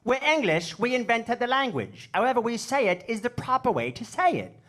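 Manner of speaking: high, loud mock English accent; indignant English friend. English friend defending his pronunciation to comic, high insistent English accent. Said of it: "And he says".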